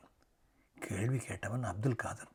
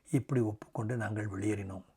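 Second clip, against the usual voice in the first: ordinary delivery, nothing marked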